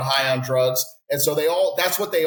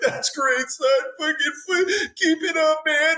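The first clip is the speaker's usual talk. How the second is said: very high, strained, woozy voice; drugged dad; 13 semitones higher than usual. Retold or imitated. Dying dad answering his son, high woozy strain. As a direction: croak it out high like you're half dead. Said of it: "And I'm like".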